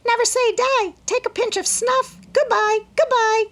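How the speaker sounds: very high, loud parrot squall; shrieking bird voice. A narrator's reading. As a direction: squawk it like a dancing parrot. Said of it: "squalled Polly".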